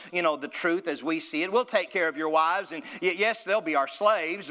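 high, loud, fast-talking persuader; wheedling Pharaoh striking a deal. Acted.

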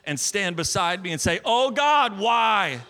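loud, high, driving preacher's delivery; full-force preaching. Acted. Pastor preaching his healing story to the church, loud and fervent. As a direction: preach it out to the congregation with fire.

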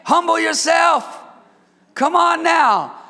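loud, high preacher's cry; full-force preaching. Acted. Pastor urging the church, preaching loud. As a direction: preach it out to the congregation.

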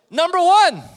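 booming point. Real, not acted. Loud point opener after laughter.

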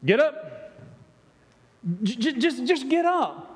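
blunt command. Retold or imitated. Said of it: "he's there, and he's like".

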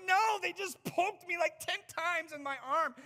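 high, loud, slow whimper; hammed-up tearful whine; mock whiny complaint. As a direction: whine like a poked child. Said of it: "I'm like".

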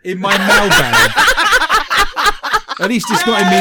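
loud, high, laughing through words. Real, not acted. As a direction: giggle while you say it. Laughing about the piercing joke.

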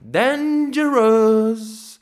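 high, loud, very slow stretched cry; drawn-out shout. Acted. Opening a vlog with a drawn-out shout. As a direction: stretch it out like a cartoon alarm.

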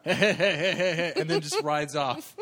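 laughing through the words, slow; cracking up while telling it. Real, not acted. Podcaster laughing at the bandit riding off.

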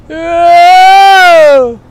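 loud high panicked "Oh!"; terrified cry. Retold or imitated. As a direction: cry out in fear as the plane skids. Scream during crash landing.

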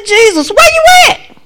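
high, loud shout for help; frantic shout. Retold or imitated. Dreamer shouting for Jesus, high and loud. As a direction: yell out for Jesus.

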